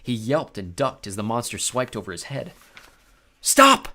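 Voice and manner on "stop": high, loud desperate cry; panicked plea. Acted. Grover about to be eaten, high panicked plea. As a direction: beg in terror. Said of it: "Grover pleaded".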